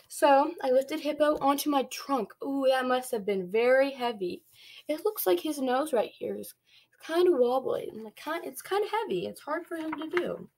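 ordinary delivery, nothing marked

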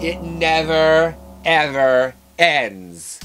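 loud, drawn-out doom; dramatic slow punchline. Acted. Storyteller ending a scary reincarnation story, slow loud mock dread. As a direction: stretch each word out loudly for mock horror.

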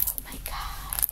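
breathless whispered oh my God; awed whisper. Real, not acted. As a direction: whisper it in awe at the picture. Awestruck at the finished painting.

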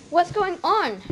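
loud, high alarmed shriek; shrieking mother. Acted. Mother hears the commotion from below, shrieking. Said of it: "shrieked his mother".

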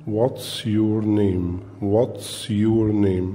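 What's your name drawn out, stress on your, falling end; very slow model question. Shown as a demonstration. Teacher drills the question for the child, very slowly. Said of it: "بنبتدي ندربه على السؤال".

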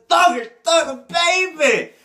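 high, loud hype for the feature; excited hype shout. Real, not acted. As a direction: shout the name like an ad-lib. Hyping the featured rapper.

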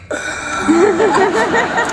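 very high gasp; mock swooning gasp. Man whose leg is touched, high mock gasp. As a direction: squeak out a little overcome noise. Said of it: "he was like".

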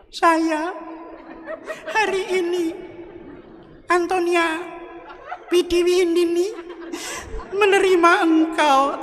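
high, wavering mock-tearful bride voice. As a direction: squeak out the vows like a bride about to cry. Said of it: "karena istrinya nangis".

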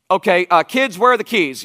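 put-on barking at the kids. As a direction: demand the keys from everyone. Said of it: "I bark and I bark".

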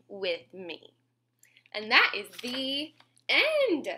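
put-on lilting read-aloud to kids; sing-song storybook reading; reading to children. A narrator's reading. Reading a bedtime rhyme to little listeners, sing-song.

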